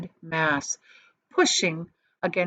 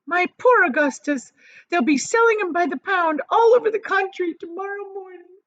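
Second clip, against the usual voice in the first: high, loud distraught wail; 12 semitones higher, 5 dB louder than usual. A narrator's reading. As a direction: wail over the lost son. Mother wailing over her son.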